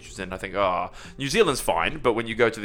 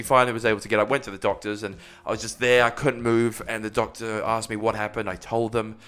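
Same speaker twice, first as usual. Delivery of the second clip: ordinary talk, nothing marked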